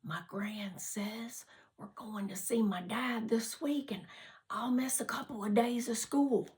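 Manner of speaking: leaning in, unvoiced whisper; hushed news about seeing dad; whispered secret. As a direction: share the secret so nobody hears. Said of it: "leaned forward, nobody listening".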